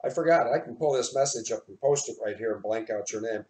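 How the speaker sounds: ordinary talk, nothing marked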